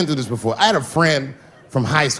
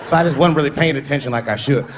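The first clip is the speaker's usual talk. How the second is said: ordinary talk, nothing marked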